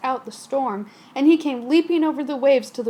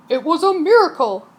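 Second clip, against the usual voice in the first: louder joyful cry; cried; 3 semitones higher, 7 dB louder than usual. Acted. Captain rejoicing after the storm. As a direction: shout it with relief. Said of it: "cried the captain".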